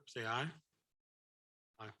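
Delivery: usual speaking voice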